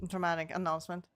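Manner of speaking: ordinary talk, nothing marked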